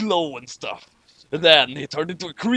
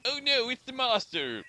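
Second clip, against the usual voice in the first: mock panic; 8 semitones higher, 7 dB quieter than usual. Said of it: "everyone is shouting".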